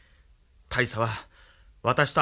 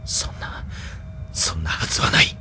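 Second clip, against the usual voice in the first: whispered denial; 9 semitones lower than usual. Acted. Denying his own weakness alone.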